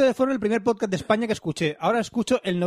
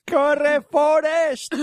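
high, loud drawn catchphrase; mock shout; 8 semitones higher, 5 dB louder, much slower than usual. Host repeating the four S pun, shouted film line. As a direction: holler it after a runner.

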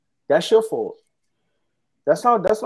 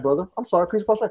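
ordinary talk

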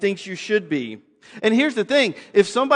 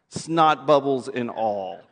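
normal talking voice

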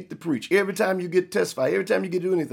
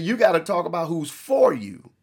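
normal talking voice

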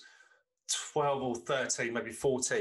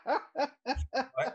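laughing through the word Right, pitched high; 12 semitones higher than usual. Real, not acted. Telling the prank to his friend, breaking into high laughter.

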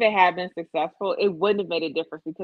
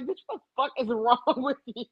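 Crystal's disbelief; 5 semitones higher than usual. Retold or imitated. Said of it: "Crystal be looking at my ass like".

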